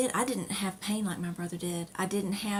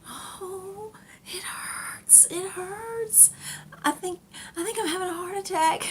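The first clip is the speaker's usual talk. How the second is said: imitating brother in pain; 11 semitones higher, slower than usual. Brother wheeled out of surgery, moaning in high pained voice. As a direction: mimic a groaning patient who thinks he is dying. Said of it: "he started saying".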